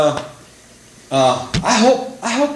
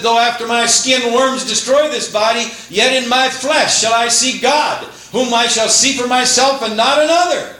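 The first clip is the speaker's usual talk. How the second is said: fervent scripture quote; 8 semitones higher than usual. Acted. Preacher quoting Job with fervour.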